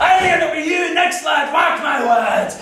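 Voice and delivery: high, loud prophecy of doom; hammed-up dread; alarmed warning. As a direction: shout a dire warning, dragging out the end.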